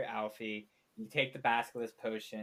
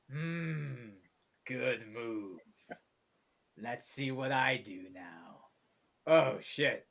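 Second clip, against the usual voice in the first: dungeon voice; 2 semitones lower, slower than usual. Acted. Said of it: "the voice from the dungeon go".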